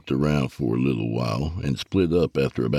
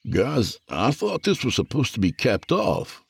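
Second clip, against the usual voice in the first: higher, worried question to the guys; uneasy, pitched-up query; concerned; 8 semitones higher than usual. Acted. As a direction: voice lifted with mild concern. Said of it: "I said in a concerned tone".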